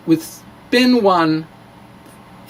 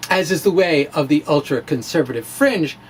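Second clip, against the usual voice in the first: ordinary delivery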